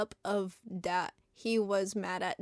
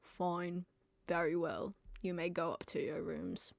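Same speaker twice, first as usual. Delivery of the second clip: soft, low, drawn stern teacher; Snape voice; 4 semitones lower, 9 dB quieter than usual. A narrator's reading. Snape dismissing the students, soft low voice. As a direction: say it low and cold. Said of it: "Professor Snape said".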